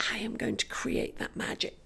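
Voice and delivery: soft playful whisper. Pretend. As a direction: breathe it like a spell. Playful magic whisper, unvoiced.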